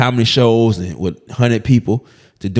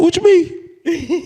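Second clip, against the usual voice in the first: much higher, slow, laughing crying voice; teary squeak; much slower than usual. Retold or imitated. Replying to Cam while crying, in a high tearful squeak. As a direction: squeeze it out mid-sob. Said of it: "Just like that".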